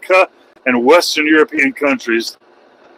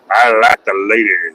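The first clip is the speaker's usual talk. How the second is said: heterosexual sounding; 6 semitones lower than usual. Shown as a demonstration. Streamer says it to sound more heterosexual, mock suave. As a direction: say it like a straight guy on the prowl. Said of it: "it sounds more heterosexual when I say".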